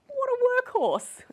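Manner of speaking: high, loud, drawn-out mock cheer; mock admiration. Satirical host hails the prime minister's holiday work, mock admiration. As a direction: exclaim it slowly with hammed-up admiration.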